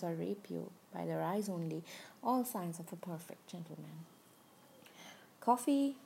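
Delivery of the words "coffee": higher, louder call across the room. Acted. Father-in-law bringing coffee to daughter-in-law, called out loud. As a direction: announce the coffee brightly, loud.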